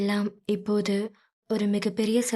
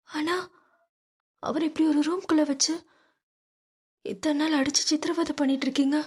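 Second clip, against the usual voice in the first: put-on higher young woman pleading; pleading daughter; 7 semitones higher, faster than usual. Meera pleading with Aadhi for her father, higher voice. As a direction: beg him to spare her father. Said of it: "மீராவின் கெஞ்சல்".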